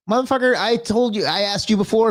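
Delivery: high-pitched exasperated outburst; mock outrage. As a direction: blow up at the bot in exasperation. Scolding ChatGPT over pronunciation.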